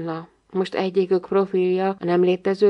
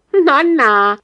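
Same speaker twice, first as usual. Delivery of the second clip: high, loud little objection; small child protesting; 7 semitones higher, 8 dB louder than usual. A narrator's reading. Little one objecting to the rhyme, high protest.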